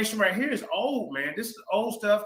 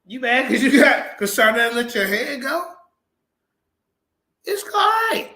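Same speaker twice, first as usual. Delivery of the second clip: taunting; 5 semitones higher, 6 dB louder, slower than usual. Real, not acted. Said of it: "You crying".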